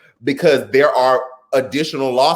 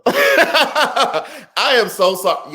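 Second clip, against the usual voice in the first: high, slow apology through laughter; laughing apology; 11 semitones higher, much slower than usual. Real, not acted. Apologizing to a viewer for mispronouncing the name, laughing. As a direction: break up laughing while saying sorry.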